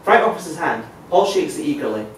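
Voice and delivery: ordinary talk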